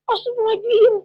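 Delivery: high, loud startled cry; acted shocked exclamation. Husband reacts as motorbike crashes into container, shocked cry. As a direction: imitate a startled rider's outburst.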